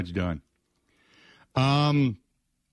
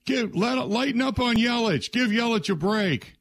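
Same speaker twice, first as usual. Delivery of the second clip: high-pitched mock yell, no real volume; imitated heckler's yell; 12 semitones higher than usual. Fan yelling at the host to go easy on Yelich, high mock yell. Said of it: "another guy yelled in".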